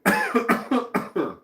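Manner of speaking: hard choking cough, high and loud; sudden fit of coughing; coughing up water. Real, not acted. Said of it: "went down the wrong pipe".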